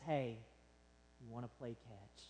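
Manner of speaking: low, very soft retold plea; tender, hushed invitation. Farmer asking his returned dad to play, tender and hushed. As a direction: voice the reunion quote gently, dropping away.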